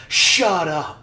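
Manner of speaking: loud, high cut-off shout; shouted catchphrase. Acted. Friend re-enacting a college saying, loud mock shout. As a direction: blurt it out to shut someone down. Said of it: "We had a saying".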